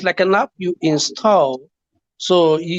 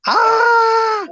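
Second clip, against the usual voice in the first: shocked shriek; 4 dB louder than usual. Real, not acted. Reacts to a crude question.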